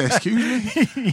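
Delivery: high, slow, drawn-out indignation; mock indignant. Man on the phone, slow high indignant. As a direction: stretch it out, offended. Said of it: "he said".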